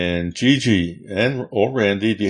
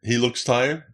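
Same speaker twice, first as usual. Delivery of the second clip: normal talking voice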